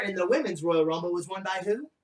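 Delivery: ordinary talk, nothing marked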